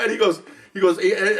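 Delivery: usual speaking voice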